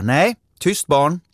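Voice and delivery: teacher calling out. Acted. Teacher calls out to silence the giggling children, loud and slow. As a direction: hush the class firmly from the front. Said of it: "Fröken ropar".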